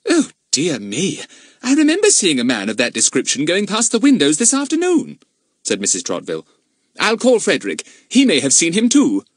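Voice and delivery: high, loud stern lady; haughty mistress of the house. Acted. Narrator voices Mrs Trotville.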